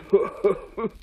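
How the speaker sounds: mischievous monster giggle; creature giggle. A narrator's reading. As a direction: giggle into his ear. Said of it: "giggled the squamp".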